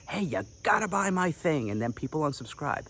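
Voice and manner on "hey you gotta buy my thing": pushy fairground pitch, higher; lightly hammed-up snake oil salesman; snake oil seller. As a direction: hustle them like a huckster at the fair. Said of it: "you sound like a copywriter selling snake oil".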